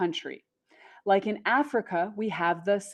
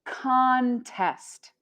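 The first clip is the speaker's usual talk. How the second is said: contest as a drawn-out CON-test; noun stress on first syllable; 3 semitones higher than usual. Shown as a demonstration. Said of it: "we have the noun".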